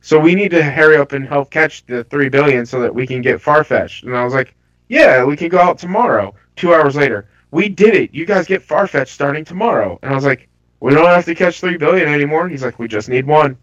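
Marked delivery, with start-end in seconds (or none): none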